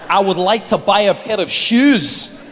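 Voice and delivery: slow, careful put-on Scottish accent. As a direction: fake Scottish accent, trying to pass as a local. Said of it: "try and learn the Scottish accent".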